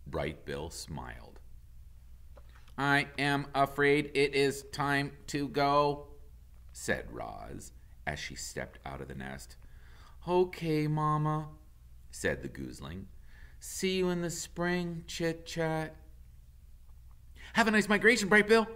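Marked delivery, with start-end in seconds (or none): 2.8-6.1 a touch of a measured robot voice; lightly voiced robot mother. Robot Roz speaks. 10.3-11.5 put-on a lightly higher young gosling voice; hint of a young goose. Gosling answers mother. 13.6-16.0 put-on a lightly higher young gosling voice; hint of a young goose. Gosling says goodbye. 17.5-18.7 high, eager squirrel chatter; squeaky excited squirrel voice; chatty squirrel. Acted. Squirrel says goodbye.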